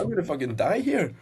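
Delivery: high-pitched mock panic; retold panicked wail. Retelling a retching fit to mates, panicked wail. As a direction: wail it as if about to pass out, hammed up. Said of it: "I was screaming".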